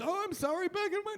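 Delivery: high-pitched mock apology; defensive grandpa. Grandpa excusing himself to horrified grandkids, defensive. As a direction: plead back in a put-on high voice.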